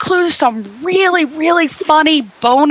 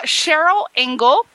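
ordinary delivery

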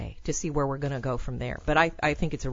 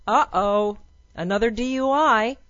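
higher, louder singsong uh-oh; mock uh-oh, pitched up; mock dismay; 5 semitones higher, 5 dB louder than usual. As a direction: announce it like naughty news. Mock alarm at celebrity news.

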